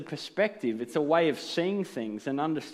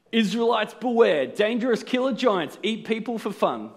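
dramatic headline; 5 semitones higher, 4 dB louder than usual. Pretend. Dramatic doomsday headline read out.

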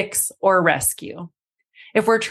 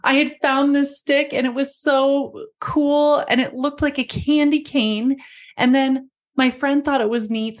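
higher, lightly voiced child, mildly tearful; child pleading over a lost treasure; upset six-year-old; 5 semitones higher than usual. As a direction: imitate a small kid who lost her stick. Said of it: "and was saying".

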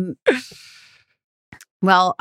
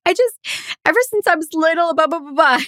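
mock sobbing breakdown; 11 semitones higher, faster than usual. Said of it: "somebody breaks down and she's like".

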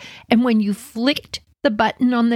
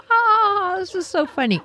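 gloating taunt; 12 semitones higher than usual. Retold or imitated. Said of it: "we're all waving from the window".

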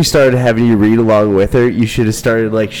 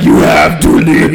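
slow, louder, spooky possessed voice; mock demon voice; 5 semitones higher, 4 dB louder, slower than usual. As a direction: pretend to be possessed to scare the ladies off. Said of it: "and just like".

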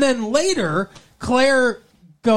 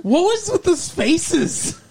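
incredulous; 9 semitones higher, slower than usual. Real, not acted. Mocking the show's odd faces.

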